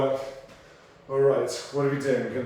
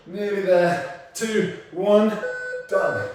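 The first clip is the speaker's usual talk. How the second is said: higher, louder, slow push to the finish; urging countdown; 6 semitones higher, 6 dB louder, slower than usual. Real, not acted. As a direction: drag them over the line. Trainer counting down final seconds.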